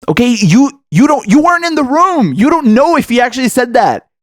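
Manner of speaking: rising to an angry shout at the moderator; getting mad. Real, not acted. Vlogger scolding a debate moderator, shouting angrily. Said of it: "why am I getting so mad?".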